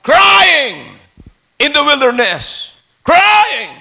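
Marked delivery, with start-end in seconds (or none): 0.1-0.9 loud, high preacher's cry. Shown as a demonstration. 3.0-3.7 high preacher's shout; crying out. Shown as a demonstration. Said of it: "Hindi talking".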